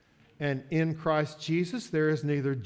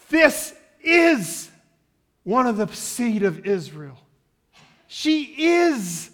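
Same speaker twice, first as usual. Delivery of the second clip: joyful exclamation; 6 semitones higher, 9 dB louder, slower than usual. Acted. Jesus hears the woman's faith.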